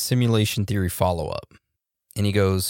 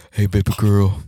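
mock smooth-talker line, said louder; imitating Donnie; 6 dB louder than usual. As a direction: ham up a pickup line like a buddy would. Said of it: "You're gonna pull a Donnie".

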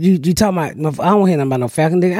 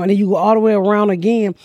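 ordinary talk, nothing marked

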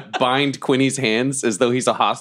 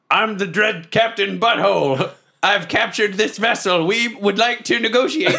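higher-pitched, hammed-up pirate proclamation; dread pirate captain yell; 7 semitones higher than usual. Captain hailing the other ship, hammed-up yell. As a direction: call across the water in mock grandeur. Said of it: "I yell at the other ship".

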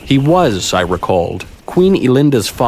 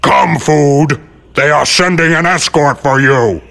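higher, forceful beast command; commanding monster voice, pitched up; rumbling great Yeti; 6 semitones higher, 4 dB louder than usual. A narrator's reading. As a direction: rumble the order as a huge creature. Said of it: "and rumble".

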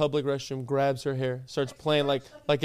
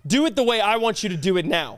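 pushy, higher-pitched bully demand; mock hostage-taker; 4 semitones higher than usual. Friend playing captor with a phone in your face, mock bullying. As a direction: badger them like a pretend bully. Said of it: "let's just flip the roles".

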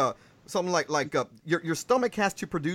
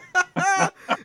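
a burst of high loud laughter; bright burst of laughter; 13 semitones higher, 4 dB louder than usual. Real, not acted. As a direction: laugh it off loudly. Host laughs at own mistake.

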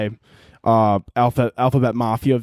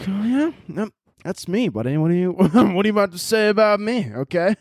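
defensive listener; 6 semitones higher than usual. Retold or imitated. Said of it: "they're like".

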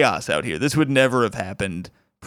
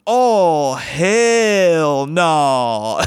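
loud, high, drawn-out sass; exaggerated heckler imitation; mock outraged refusal; 3 semitones higher, 4 dB louder, much slower than usual. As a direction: holler it, stretching each word in refusal.

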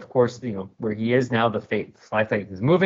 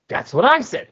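smug retort; 11 semitones higher, 4 dB louder than usual. Acted. Davrov boasting to Stealth.

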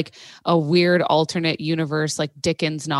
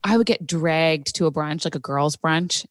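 ordinary delivery

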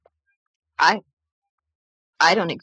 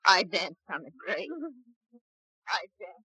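guilt-stricken murmur; 5 dB quieter than usual. Acted. Guilty confession fading away.

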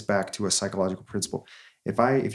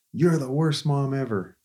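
put-on pouty kid parting shot; 5 semitones higher than usual. Son glaring back at Mom over his shoulder, slow whiny kid voice. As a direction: drag it out like a sulking six-year-old.

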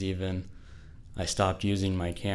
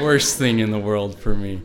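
laughing it out, louder; 9 dB louder than usual. Real, not acted. Telling the audience she hates crying, laughing. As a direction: laugh through the words at her own tears.

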